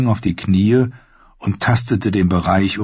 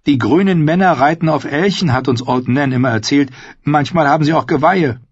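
higher-pitched, excited recounting; eager boy Bran; 8 semitones higher than usual. Acted. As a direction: play a keen boy in a high voice. Narrator voices Bran.

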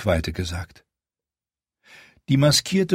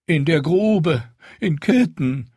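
raised, whiny wail about the chained dragons; 7 semitones higher, 3 dB louder, slower than usual. A narrator's reading. Steward wailing to the queen about chained dragons, raised whine. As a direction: moan it out in a lament. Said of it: "jammerte Retznak Moretznak".

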